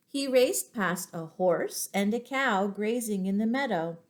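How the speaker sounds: usual speaking voice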